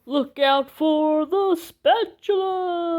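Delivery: dramatic drawn-out war cry; slow-motion battle cry. Acted. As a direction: draw it out like a slow-motion movie moment.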